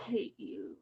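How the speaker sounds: soft, low, slow muttered hate; a hurt girl telling God she hates him, hushed; quiet hurt. Retold or imitated. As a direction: say it under the breath, wounded. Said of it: "And I remember saying".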